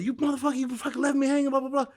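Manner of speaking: high-pitched mock rant at his brother; angry retold scolding. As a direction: tear into him again, hammed-up and high. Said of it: "And I'm telling my brother".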